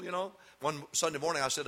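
ordinary delivery

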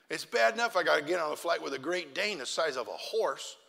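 usual speaking voice

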